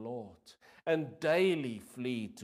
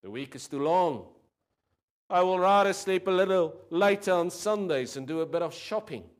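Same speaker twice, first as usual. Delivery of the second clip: higher, louder casual excuse-making; worldly person; 6 semitones higher, 8 dB louder than usual. Acted. Worldly person excusing skipping church, raised and breezy. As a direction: toss off the excuses breezily and loud. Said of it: "says the worldly person".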